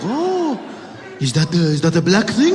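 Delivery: puzzled question in imitated Middle Eastern accent; put-on Middle Eastern accent. Voices the man asking back.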